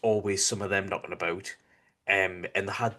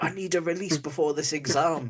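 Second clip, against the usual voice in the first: quoting Richard; 7 semitones higher, 3 dB louder than usual. Retold or imitated. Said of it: "And he's just like".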